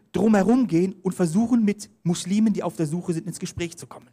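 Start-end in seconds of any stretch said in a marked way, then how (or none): none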